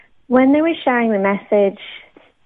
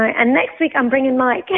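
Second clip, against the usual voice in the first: ordinary delivery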